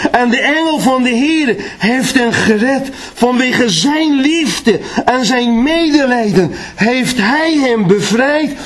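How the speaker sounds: high, ringing scripture proclamation; preaching at full force. Acted. Preacher proclaiming the rescue to the church, full force.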